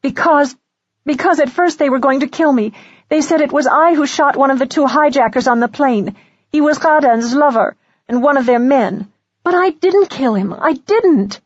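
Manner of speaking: high-pitched, impassioned protest; angry. A narrator's reading. Accused young woman denying she killed him, high and angry. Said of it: "said angrily".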